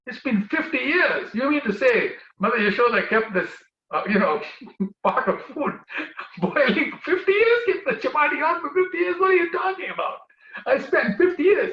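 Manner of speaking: high, loud, half-laughing disbelief; can't believe fifty years were minutes; incredulous outburst. Acted. Narad disbelieves Krishna's trick.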